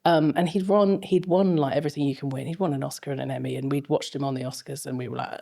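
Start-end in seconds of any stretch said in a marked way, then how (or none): none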